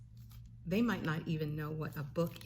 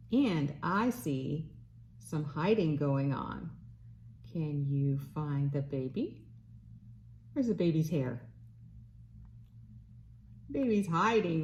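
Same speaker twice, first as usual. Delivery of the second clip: bright sing-song read-aloud voice; reading to a toddler; 3 semitones higher than usual. Acted. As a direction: read it like to a little one. Reads a picture book to baby.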